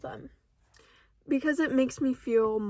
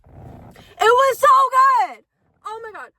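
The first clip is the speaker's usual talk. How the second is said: excited squeal; 9 semitones higher, 10 dB louder than usual. Real, not acted. Back to gushing after the phone falls, high and loud. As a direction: belt out your delight.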